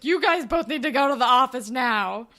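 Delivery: loud, firm imitated command; mock stern order. Teacher sends students to the office, loud mock stern order.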